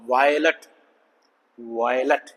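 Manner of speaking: वायलट said as a careful teaching example; diphthong plus schwa. Shown as a demonstration. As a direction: sound out both vowels in वायलट. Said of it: "एक तो diphthong बन गया".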